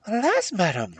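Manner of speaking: high, loud lament; sorrowful princess. A narrator's reading. Princess answering the suspicious hag, lamenting.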